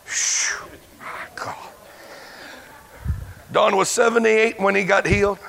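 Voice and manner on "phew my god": whispered, breathy aside; preacher catching his breath, whispered; breathless whisper. Real, not acted. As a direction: blow out the tension in a whisper. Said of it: "breathless aside".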